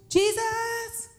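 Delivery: high, drawn-out searching call; acted call for a missing child; mock yelling. Frantic parent searching for lost son, mock yelling. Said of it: "Like, yelling, going crazy".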